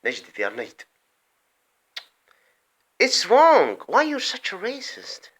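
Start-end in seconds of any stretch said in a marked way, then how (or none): none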